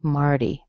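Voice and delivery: "Marty" with a soft flapped T like a D; soft American flap. Shown as a demonstration. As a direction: tap the T lightly in "Marty". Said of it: "It's been softened".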